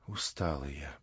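worn-out and barely audible; weak voice. Acted. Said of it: "слабым голосом". (слабым голосом, тише и ниже)

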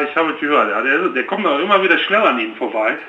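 ordinary talk, nothing marked

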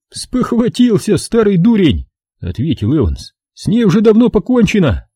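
a wiseacre spinning his theory; cocky know-it-all soldier. A narrator's reading. Evans voiced by narrator.

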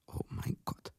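breathless, stunned whisper. Acted. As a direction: barely breathe it out in shock. Seeing dead wife. (fassungslos geflüstert)

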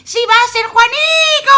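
high, loud shout; shouted reply. Acted. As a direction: yell it out from the pot. Boy answers from pot.

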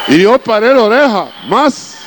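rising eagerly as he listens; excited perk-up. Retold or imitated. Preacher acts perking up. (entusiasmado, más alto y agudo)

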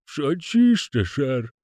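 slow, high creaky lisping servant; creaking groaning door voice. Acted. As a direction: drawl it like a creaky manservant.

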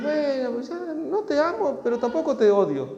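high put-on voice of a bored husband; lightly voiced spouse, shrugging it off; a hint of a cold partner; mock indifferent spouse. Spouse voiced giving up on love.

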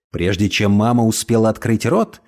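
ordinary delivery

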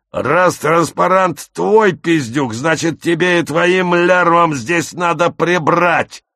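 loud, high, slow abusive order; bullying harangue. Acted. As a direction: squad leader's angry ranting command. Squad leader abuses and orders victims.

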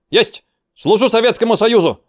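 high, loud zealous answer; eager shouted reply. Acted. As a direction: answer with bursting zeal. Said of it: "Рвение так и прёт".

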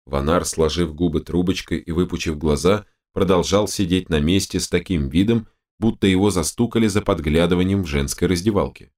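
usual speaking voice

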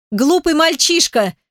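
high-pitched indignant cry; exclamation. A narrator's reading. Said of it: "воскликнула Зизуми". (возмущённый возглас высоким голосом)

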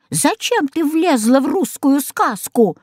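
witch yelling. A narrator's reading.

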